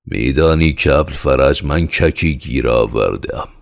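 proud, loud boast; loud announcement. Acted. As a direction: announce the catch loudly like a delighted child. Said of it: "با صدای بلند گفت".

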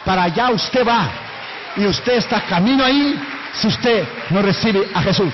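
loud, forceful warning shout. Retold or imitated. Preacher warning the man in the sauna, loud. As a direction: warn him hard about hell.

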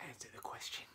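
hushed teasing prod; whisper. Pretend. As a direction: whisper it like a stage prompt. Vriendin spoort plagerig aan.

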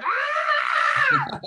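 very high, loud comic wail; exaggerated crying howl; mock bawling. Actor imitating himself bawling after the take, mock wail.

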